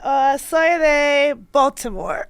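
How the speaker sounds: slow, careful classroom Spanish; halting, slow, high schoolbook Spanish; sixth-grade Spanish. Real, not acted. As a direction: sound out each word like a nervous student. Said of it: "it's giving sixth grade Spanish".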